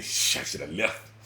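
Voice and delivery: low, slow muttered regret; slow, low private dread; inner thought. Retold or imitated. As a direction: mumble it to yourself. Inner thought as man approaches.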